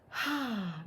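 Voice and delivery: soft, breathed-out sigh. Shown as a demonstration. Coach showing how to pause before responding, soft sigh. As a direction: exhale gently to settle down. Models taking a deep breath.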